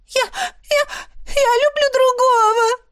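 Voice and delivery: put-on very high, stammering girl's wail; hysterical cry. A narrator's reading. Izzy confesses to Ash she loves another, stammering high wail. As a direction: stumble then blurt the confession. Hysterical girl protests marriage.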